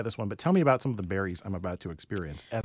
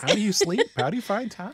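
amazed; 9 semitones higher than usual. Real, not acted. Amazed at one-person bakery.

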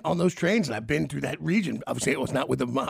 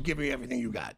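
mock robber demand; 4 semitones lower, 6 dB quieter than usual. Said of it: "go from person to person and go".

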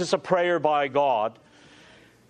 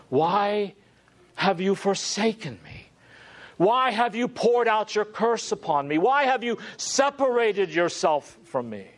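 anguished plea; 6 semitones higher than usual. Retold or imitated. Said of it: "what he said earlier".

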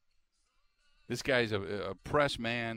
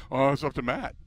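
passing the buck; 6 dB louder than usual. Retold or imitated. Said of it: "He, he said".

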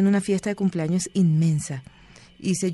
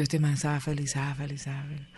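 fading to near silence, lower; fading mutter; 4 semitones lower, 7 dB quieter than usual. Retold or imitated. She talks herself out of the man, fading away. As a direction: trail off, softer each time. Retold inner talk fading.

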